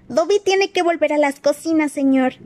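high squeaky elf voice; chillido de elfo. Acted. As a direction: squeal it like an anxious house-elf. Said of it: "chilló Dobby". (voz de elfo chillona y aguda)

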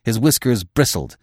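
usual speaking voice

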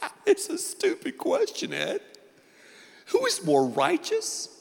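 high-pitched mock heckler; squeaky put-on voice of an incredulous listener; scoffing audience member. Preacher voices a crowd member scorning his question, high mock-incredulous voice. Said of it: "some of you in a crowd this size are going".

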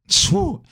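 long, high, breathy phew of admiration; swooning phew. Real, not acted. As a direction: let out a dreamy, high-pitched phew. Swooning over R&B singers.

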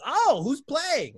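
put-on high, slow, innocent old lady; grandma voice. Grandma asks her grandson who's playing, high and slow. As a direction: ask it sweetly like a clueless grandma. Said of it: "She's like".

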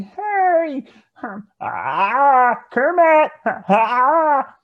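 very high, loud frog puppet yelp; Kermit the Frog voice. Pretend. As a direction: squeal it out like Kermit. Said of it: "my Kermit the Frog impression".

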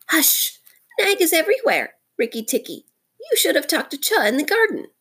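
high, anxious creature voice; broken-hearted muskrat. A narrator's reading. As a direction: warn timidly in a high little voice. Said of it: "Chakundra is a broken-hearted little beast".

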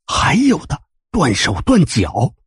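plain reading voice

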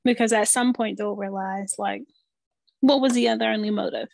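usual speaking voice

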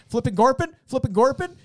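high, loud garbled guard shouting; garbled security guard. Retold or imitated. Retelling a sketch's security guard, loud high garble. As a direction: bark out nonsense words like a mumbling guard.

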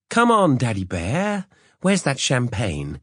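high, loud, slow call from the bathroom. Acted. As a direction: call out to him in the next room, sing-song. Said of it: "she called out to him".

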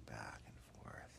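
soft unvoiced whisper; whispered narration. A narrator's reading. Narrator whispering the bedtime story to a child.

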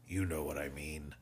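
low, mildly irritable retort; a touch testy. A narrator's reading. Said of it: "said Captain Haydock irritably".

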